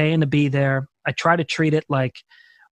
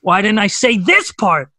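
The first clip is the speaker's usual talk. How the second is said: louder, higher, slow frustrated complaint; exasperated self-questioning; 5 semitones higher, 5 dB louder, slower than usual. Retold or imitated. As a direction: groan at yourself over the missed part. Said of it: "I was like".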